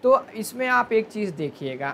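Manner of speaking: ordinary delivery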